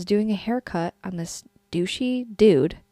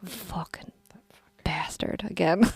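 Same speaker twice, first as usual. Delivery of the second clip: hushed, slow swearing; whispered curse; 3 semitones lower, 6 dB quieter, slower than usual. Real, not acted. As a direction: breathe the insult slowly. Cursing the client in a whisper.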